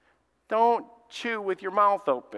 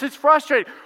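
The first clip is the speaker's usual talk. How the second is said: normal talking voice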